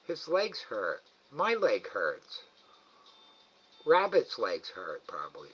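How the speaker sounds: ordinary delivery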